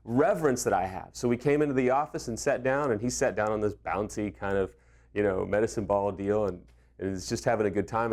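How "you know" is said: usual speaking voice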